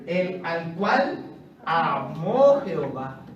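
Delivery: reverent reading. Acted. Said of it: "y lo más lindo lo que sigue".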